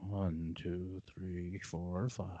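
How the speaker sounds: very low, very soft counting after a sigh; calming count. Retold or imitated. Manager holding back at rude customer, hushed calming count. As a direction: count under your breath to keep control.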